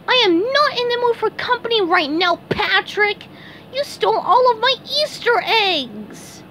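very high cartoon complaint; upset SpongeBob. SpongeBob accuses Patrick of stealing his eggs, upset. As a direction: scold him in a high, wounded voice.

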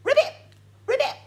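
high, loud croaky ribbit; playful frog sound for children; frog noise. Shown as a demonstration. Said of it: "what does a frog say".

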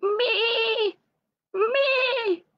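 very high bleating Me; sheep bleating. A narrator's reading. Lamb Lumi crying from the well, high bleat. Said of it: "bleating mercilessly".

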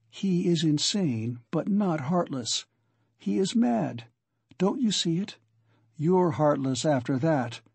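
ordinary delivery

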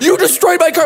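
high, loud mock yell; angry yell. Imitating the owner yelling again about his car. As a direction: bark it at the driver.

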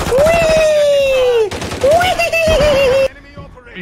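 high gleeful squeal; playful whee. Pretend. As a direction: squeal like a kid on a ride. Riding the carousel.

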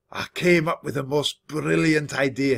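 usual speaking voice

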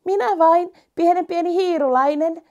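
high, small and eager little mouse; tiny mouse voice. Acted. (pikkuhiiren korkea ääni)

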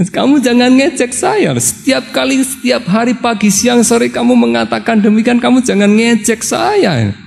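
a fed-up husband snapping back at the daily teasing; retold exasperated shout; annoyed outburst. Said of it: "Akhirnya Upaka ini kesel loh".

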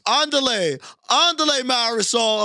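a touch of cartoon Mexican accent; mock Spanish exclamation. Mimicking Mauricio's Spanish line.

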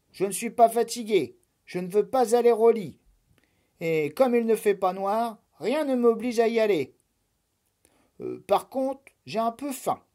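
put-on high, loud, fast childish protest; fast whiny bunny protest; stubborn rabbit. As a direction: rattle off excuses like a kid. Lapin insists he's not tired.

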